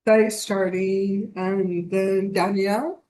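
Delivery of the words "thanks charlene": ordinary talk, nothing marked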